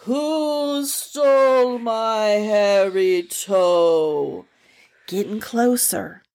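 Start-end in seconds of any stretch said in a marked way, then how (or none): 0.0-4.5 very slow, drawn-out spooky moan; haunting faint call; ghostly moaning voice. Acted. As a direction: moan it like the wind far off.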